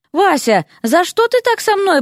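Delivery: high-pitched aggrieved plea; hissed reproach. A narrator's reading. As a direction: reproach her for betraying you. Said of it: "прошипел тот". (обиженно, почти шипя)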